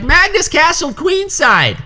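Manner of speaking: put-on slow, very high-pitched kid voice; drawn-out falsetto mock announcement; imitated excited kid. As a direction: squeal it like an amazed child. Acted kid reporting the move.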